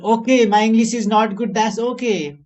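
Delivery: loud, high, emphatic self-acceptance; defiant acted reply. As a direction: proclaim it boldly, as if answering a critic. Acted reply to an imagined critic.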